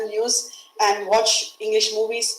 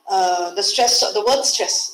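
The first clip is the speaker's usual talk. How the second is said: ordinary talk, nothing marked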